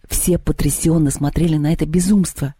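ordinary delivery

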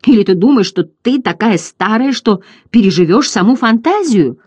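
loud, high, indignant challenge; angry. A narrator's reading. Boy angrily challenging the ancient turtle, loud and high. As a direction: challenge her in anger. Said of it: "гневно воскликнул Атрей".

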